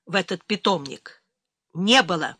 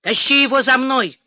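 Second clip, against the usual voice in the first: loud, higher-pitched command; raised-voice command; barked order; 4 semitones higher, 7 dB louder than usual. A narrator's reading. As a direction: order him sharply to follow. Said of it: "приказал подполковнику".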